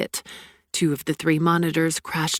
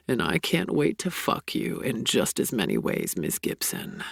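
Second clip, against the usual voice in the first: lightly voiced lover; 5 semitones lower, 4 dB quieter than usual. A narrator's reading. Sebastian's low voice.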